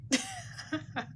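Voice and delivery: a tiny squeaky giggle; laugh. Real, not acted. Vlogger giggling after the money joke. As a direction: giggle to herself.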